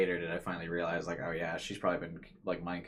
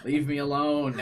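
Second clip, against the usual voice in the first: louder, higher cry of leave me alone; anguished outburst; 6 semitones higher, 7 dB louder, slower than usual. Acted. Maho lashing out at Kurisu in a raised cry. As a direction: snap at her to get away.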